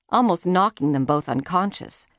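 ordinary delivery

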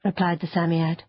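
ordinary delivery

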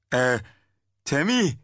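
a timid follower testing the waters; hesitant young man. Acted.

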